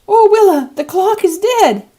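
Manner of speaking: put-on very high, loud, slow child's cry repeated; boy pleading to Willa in alarm; high loud dismayed child voice, slow; aghast child gasp. A narrator's reading. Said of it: "he gasped".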